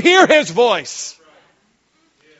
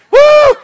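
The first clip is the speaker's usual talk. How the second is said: high, loud whoop; joyful woo; 13 dB louder than usual. Real, not acted. Preacher whooping about hunting season fire. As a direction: whoop like a hunter at dawn.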